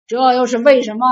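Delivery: loud, demanding question; shouting question. A narrator's reading. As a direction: bark out the question. General demands a reason.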